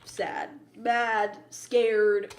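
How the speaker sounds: usual speaking voice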